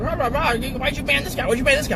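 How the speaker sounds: high-pitched, rapid mock pestering; clamoring chat mob. Viewers demanding why someone got banned, mock clamoring. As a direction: badger like an indignant crowd.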